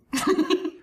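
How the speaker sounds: short high laugh. Real, not acted. Laughs at being called out.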